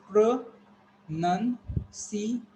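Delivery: "pronunciation" broken into pruh, nun, see, ay, shun; very slow, with a pause after each syllable of "pronunciation". Shown as a demonstration. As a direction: sound out "pronunciation" piece by piece. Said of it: "you people have to understand".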